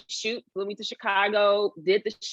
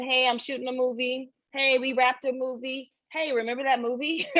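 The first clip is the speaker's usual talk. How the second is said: higher, chipper social-media brag; pitched-up retold posts; upbeat announcer of own news; 5 semitones higher than usual. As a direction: lightly imitate someone proudly posting updates. Voices the director's upbeat posts.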